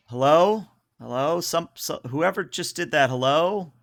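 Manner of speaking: trembling, unsure call over an intercom; shaky voice. Acted. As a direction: call out nervously, voice wobbling. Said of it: "a shaky voice".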